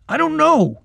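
high, loud, irritated retort; exasperated and sharp; snapped. A narrator's reading. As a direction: snap back at a friend. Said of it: "he snapped".

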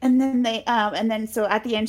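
usual speaking voice

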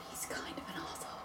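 very soft confiding whisper; hushed insult behind someone's back; whispered aside. Acted. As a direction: whisper it like a guilty admission. Confiding doubts about an absent companion.

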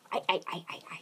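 overacted death scene. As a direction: fake choking, whispered gasps. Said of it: "oh, she's choking 'cause she's".

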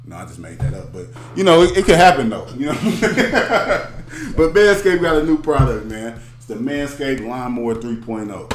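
ad read. Acted.

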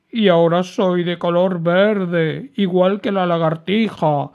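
high, loud whiny complaint; a big dragon sobbing out his loss; crying dragon. A narrator's reading. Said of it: "mientras seguía llorando".

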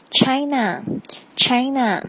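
China said slowly with a clear English ch sound, not the Chinese chi; slow teacher demo of China; careful English China. Shown as a demonstration. Teacher showing learners China, slow and clear. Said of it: "请注意这个C H的发音".

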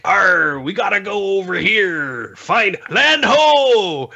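hammy pirate growl, high and drawn out; goofy pirate captain shouting orders. Retold or imitated. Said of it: "being pirates".

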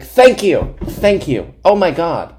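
high, loud mock thanks; hammed-up relieved thanks; theatrical gratitude. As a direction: gush as if finally vindicated. Theatrical gratitude to friend, retold.

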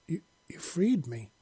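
stammering amazed cry; disbelieving, just freed; stunned exclamation. Acted. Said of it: "he exclaimed".

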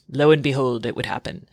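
ordinary talk, nothing marked

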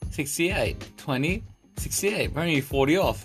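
ordinary delivery

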